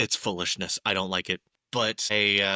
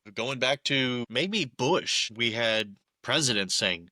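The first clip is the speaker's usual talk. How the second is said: ordinary talk, nothing marked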